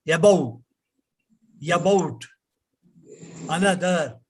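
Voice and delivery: ABOVE, ABOUT, ANOTHER with every vowel full; non-English pronunciation. Shown as a demonstration. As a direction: sound out each syllable of ABOVE, ABOUT, ANOTHER. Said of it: "you don't hear".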